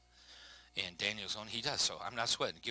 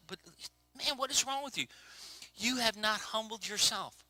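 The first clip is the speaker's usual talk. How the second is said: exasperated rebuke; 5 semitones higher, 6 dB quieter than usual. Acted. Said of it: "he's like".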